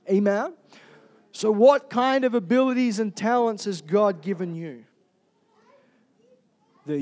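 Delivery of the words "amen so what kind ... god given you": normal talking voice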